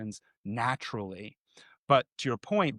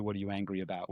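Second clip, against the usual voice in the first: much softer, calm and caring; soft calm asking; gentle question; 6 semitones lower, 10 dB quieter, faster than usual. Shown as a demonstration. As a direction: ask it kindly.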